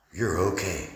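low, soft and soothing; gentle reassurance. Retold or imitated. A giant hand on his back comforts him, gentle reassurance. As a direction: reassure tenderly, voice dropped right down. The comforting hand's message, acted.

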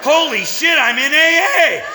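high, loud burst of amazement; elated realization. Acted. Member suddenly realizes he belongs, elated realization. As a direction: cry out as if it just dawned.

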